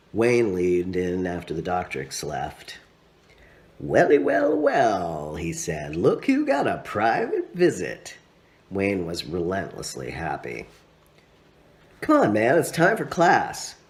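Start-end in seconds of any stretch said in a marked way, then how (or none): 3.8-5.4 high, sing-song teasing greeting; a gleeful tease drawing out the wells; hammed-up cheerful Wayne; Wayne's voice. A narrator's reading. 6.0-8.2 chuckling tease; playful, laughing Wayne; teasing laugh. A narrator's reading. 12.0-13.8 upbeat, louder and higher; rally a friend to class; bouncy, happy Wayne; cheerful call. A narrator's reading.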